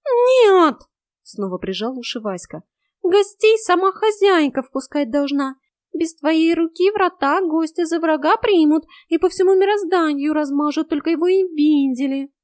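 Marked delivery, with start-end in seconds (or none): 0.1-0.8 high, loud cry; very high cat yelp; panicked cat. A narrator's reading. 3.0-12.3 high, loud cat voice; high-pitched, insistent warning; alarmed cat. A narrator's reading.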